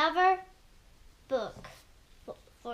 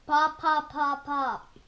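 light cork pops, louder at first; popping cork; 4 dB louder than usual. Acted. Reader showing children Mister Brown's cork noise, popping. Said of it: "He can sound like a cork".

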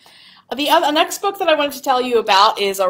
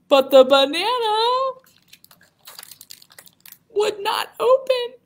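high, strained storybook woe; dramatic dismay; 12 semitones higher than usual. A narrator's reading. Reader telling children of Betty's stubborn banana, dismayed. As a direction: pitch it way up, struggling in mock frustration.

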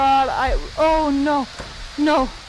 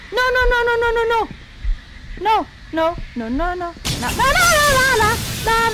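panicked pleading; 5 semitones higher than usual. Real, not acted. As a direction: plead desperately in terror. Panicking at horror game.

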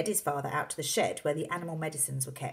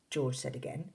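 usual speaking voice

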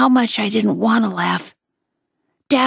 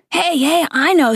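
higher, eager burst of a sudden idea; excited; 7 semitones higher than usual. Acted. Dad cutting in with a joke idea, excited and higher. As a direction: jump in with a bright new idea. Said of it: "said Dad excitedly".

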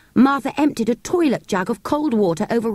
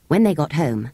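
usual speaking voice

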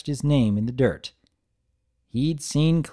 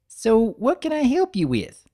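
put-on high, eager little-girl voice; little sister greeting her big brother brightly; young filly voice; 11 semitones higher than usual. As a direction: bounce it up high like an excited kid. Narrator voices Apple Bloom.

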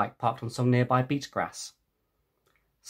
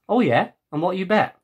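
cocky challenge; 6 semitones higher, 4 dB louder than usual. Acted. Accepting challenge.

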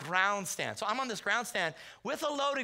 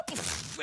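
high panicked cry; comic yelp as the shot goes; imitated yelp; 7 semitones higher than usual. Hunter firing at a charging deer, mock panicked yelp. Second scream while firing.

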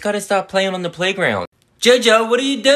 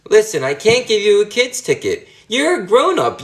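usual speaking voice

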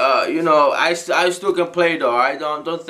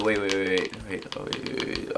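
voiceless urgent wait, wait; whisper; 10 semitones lower, 9 dB quieter than usual. Acted. Gamer whispering to teammates while hiding, hushed and urgent. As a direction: hush so the enemy won't hear.

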